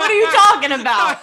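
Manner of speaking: loud high fake Boston accent, laughing. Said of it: "your fake Boston accent".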